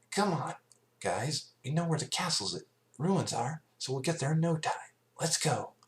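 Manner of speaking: high, quick, brash voice; rally the team, raring to go; sporty pony cheering on friends; eager Rainbow Dash. Acted.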